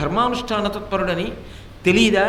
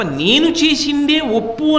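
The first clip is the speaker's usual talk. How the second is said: stubborn insistence; 6 semitones higher, 4 dB louder, slower than usual. Retold or imitated. Stubborn insisting on being right.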